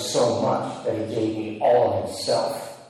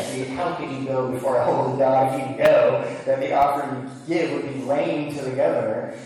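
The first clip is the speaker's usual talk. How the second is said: ordinary delivery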